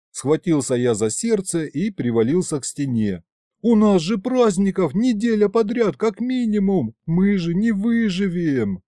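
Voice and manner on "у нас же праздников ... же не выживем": high, hammed-up alarm; panicked protest. A narrator's reading. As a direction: wail it as if disaster looms. Horrified panic over week of holidays. (в панике, высоким голосом)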